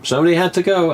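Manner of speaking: high mock insistence, retold; a hint of a stubborn actor; imitating Jamie. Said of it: "He goes".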